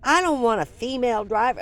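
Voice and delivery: imitating a boss yelling at her; indignant commanding officer voice; retold yell. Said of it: "he starts yelling at me".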